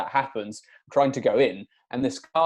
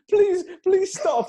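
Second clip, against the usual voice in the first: pleading wife; 4 dB louder, slower than usual. Retold or imitated.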